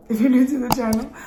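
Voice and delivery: laughing. Real, not acted. Laughs through restarted intro line.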